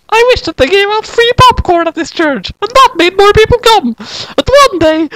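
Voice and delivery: put-on a squeaky little girl confiding; high girl voice. Kyoko telling Sayaka her wish, high girl voice.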